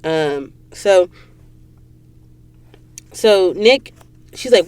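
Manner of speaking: ordinary delivery, nothing marked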